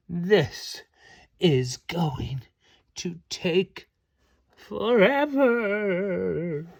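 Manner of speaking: slow drawn-out whine, forever stretched; half-whispered groaning complaint; bored. Acted. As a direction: drag every word out in boredom.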